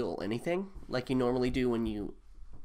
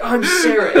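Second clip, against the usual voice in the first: very high, loud, hammed-up greeting; overeager introduction; 19 dB louder than usual. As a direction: shout it giddily, pitched way up. Game master repeats eager introduction.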